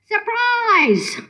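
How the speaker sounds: high, drawn-out gleeful call; high-pitched mock yell, little added volume; yelled surprise. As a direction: sing out the surprise, pitch high then falling. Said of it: "and I yelled".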